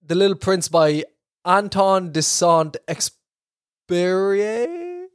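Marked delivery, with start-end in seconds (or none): 1.5-5.1 halting French pronunciation, last syllable drawn out and rising; attempted French accent. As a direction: have a clumsy go at French, stretching the ending. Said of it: "My French isn't great".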